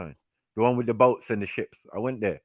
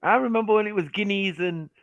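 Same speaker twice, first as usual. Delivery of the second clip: imitating mum; 8 semitones higher than usual. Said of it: "My mum said".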